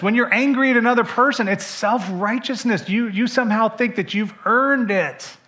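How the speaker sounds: impassioned sermon climax; full-force preaching. Acted. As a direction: preach it with fire.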